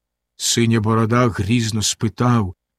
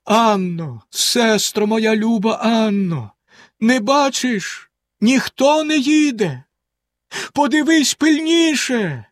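slow, much higher, desperate calling; despairing call; 9 semitones higher, slower than usual. A narrator's reading. Wife calls desperately to Anna one last time, slow and high. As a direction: call out with your last hope. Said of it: "гукнула з розпукою".

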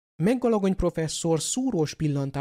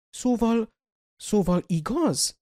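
stammered; 6 semitones higher, slower than usual. A narrator's reading. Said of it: "hebegte Meggalagony".

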